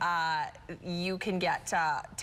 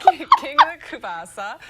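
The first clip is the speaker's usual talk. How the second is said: laughing; 7 semitones higher, 14 dB louder than usual. Real, not acted. Laughing about event name.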